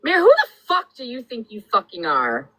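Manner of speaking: normal talking voice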